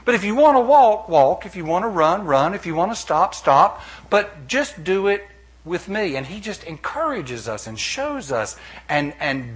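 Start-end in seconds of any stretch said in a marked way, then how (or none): 0.0-6.1 lightly raised, emphatic encouraging Jesus. Retold or imitated. Preacher voicing Jesus encouraging believers, emphatic and a little louder. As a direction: urge them on, firmly, a bit loud. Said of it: "And Jesus is saying".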